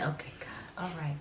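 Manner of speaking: soft whispered plea to God; whispered prayer. Retold or imitated. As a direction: breathe it out as a prayer. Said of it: "get myself together and say".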